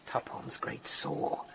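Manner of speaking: slow, soft, hushed realisation. Acted. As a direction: let it sink in slowly and softly. Realising the Bible link.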